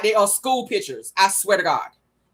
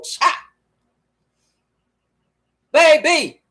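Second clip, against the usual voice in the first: ordinary talk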